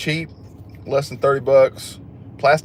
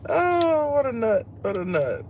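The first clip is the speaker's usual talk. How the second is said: much higher, giggly amusement fading down; high laughing squeal; 13 semitones higher, 3 dB quieter, slower than usual. Real, not acted. Reacting to a funny chat comment, squealing with laughter. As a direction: squeak it out while still laughing.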